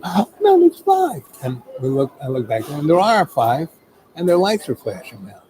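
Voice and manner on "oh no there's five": high-pitched retold alarm. Companion spotting police lights behind, alarmed. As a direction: cry out the bad news, much higher.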